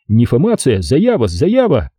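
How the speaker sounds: ordinary delivery, nothing marked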